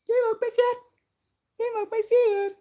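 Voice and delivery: high squeaky cartoon voice. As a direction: say it in a tiny squeaky voice. High put-on voice, then plain take.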